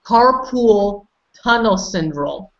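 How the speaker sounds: carpool said as car plus pool, slowly; slow, deliberate "carpool" error; wrong pronunciation. Shown as a demonstration. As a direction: mispronounce carpal as "carpool", drawn out. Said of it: "pronounce it wrongly".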